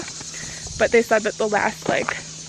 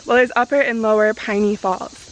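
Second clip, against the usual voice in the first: usual speaking voice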